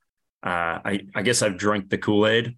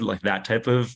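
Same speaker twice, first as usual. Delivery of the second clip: ordinary talk, nothing marked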